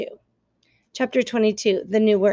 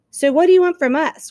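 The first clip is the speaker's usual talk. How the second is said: louder, higher threatening growl; 6 semitones higher, 6 dB louder than usual. Acted. Fox growls at the robot, louder and threatening. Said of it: "growled Fink".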